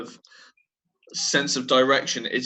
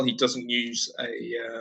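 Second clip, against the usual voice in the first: ordinary talk, nothing marked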